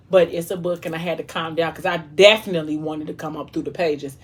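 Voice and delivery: usual speaking voice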